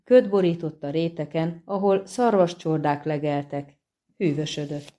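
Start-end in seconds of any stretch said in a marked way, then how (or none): none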